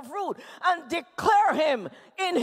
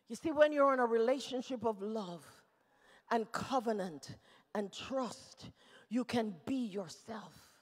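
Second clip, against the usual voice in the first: tender preaching; 7 semitones lower, 9 dB quieter than usual. Real, not acted. Preacher assuring listeners of a loving relationship, hushed. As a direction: bring it right down, intimate.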